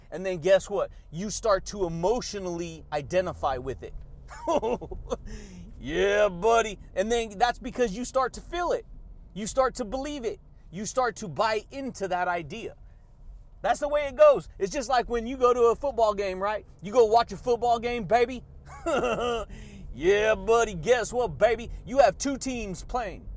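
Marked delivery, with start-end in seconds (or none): 4.0-5.7 a long, soft giggle; goofy laugh. Real, not acted. Signature laugh after a punchline. 18.5-19.9 a short, airy giggle; goofy laugh. Real, not acted. Signature laugh after a punchline.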